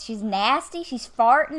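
ordinary talk, nothing marked

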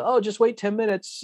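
offhand, high brush-off; breezy dismissal. Retold or imitated. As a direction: lightly imitate a clerk brushing off a customer. Voicing the agent's directive again.

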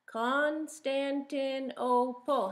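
slow, spelled out syllable by syllable. Shown as a demonstration. Teacher showing the class the syllables, slow. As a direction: stretch Constantinople so the kids can count each beat. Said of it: "How many syllables is that?".

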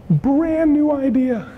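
high, slow, thrilled delivery of brand new idea; excited. Retold or imitated. Pitching a coworker, giddy and excited. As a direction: stretch it out, bursting with excitement. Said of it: "and you're so excited".

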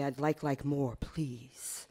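low, hushed and intimate; soft tender plea. Acted. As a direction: ask very softly, voice sinking on "please". Tender reply to her lover.

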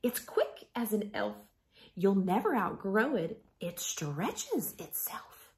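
tender picture book reading; reading to children. Acted. Teacher reads picture book aloud.

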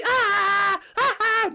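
short, loud angry cries; attack yells. Acted. Peach attacking the fans, loud battle cries. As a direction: yell out with each blow.

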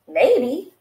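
maybe said with a falling, settled tone; thoughtful goose, falling tone; musing maybe. Acted. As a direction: ponder it, letting it drop. Goose muses, repeats maybe.

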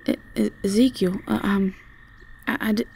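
stuttering, faltering with fear; nervous broken stammer; frightened stammer. Acted. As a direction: trip over the words, scared. Frightened by stranger at night.

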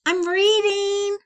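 high, loud and slow reply; shouted back. Acted. Flora answering her mother from upstairs, loud shout. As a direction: yell it back down. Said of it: "Flora shouted back".

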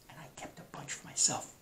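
soft whispered admission. Real, not acted. Teacher whispers to students about keeping scarves. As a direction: whisper it as a secret.

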